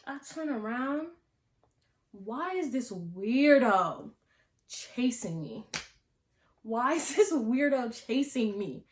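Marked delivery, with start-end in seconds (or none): 2.1-5.6 slow, stretched-out disbelief with a pause midway; drawn-out disbelief. Retold or imitated. Reacting to being chased, first take. 6.6-8.8 higher-pitched, incredulous retold outcry; exclaimed disbelief. Reacting to being chased, repeated take.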